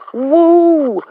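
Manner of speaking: shrill-free high "woo" of disbelief; high exasperated woo. Real, not acted. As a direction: blow off steam with a high woo. Venting anger, exclaims.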